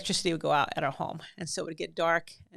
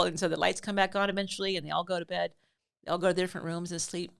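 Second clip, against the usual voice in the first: plain reading voice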